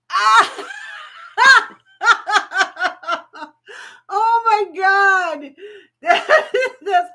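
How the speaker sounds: real laughter, high and loud; burst of laughter. Real, not acted. Host reads Christy's comment and bursts out laughing. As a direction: crack up at a funny comment.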